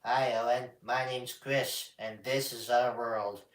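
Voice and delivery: Chris puppet voice. Acted. Puppeteer showing how Chris sounds, put-on character voice. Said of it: "he kinda sounds like".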